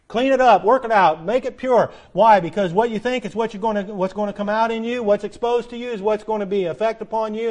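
loud, high commands to the congregation; full-force preaching. Real, not acted. Preacher exhorting listeners to purify their minds, loud and high. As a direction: drive each command home hard.

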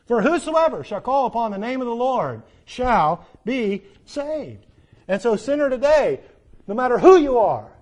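rising, loud preaching climax; full-force preaching. Acted. Preacher giving the altar invitation to sinners, climactic preaching. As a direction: build it up and call the sinner in.

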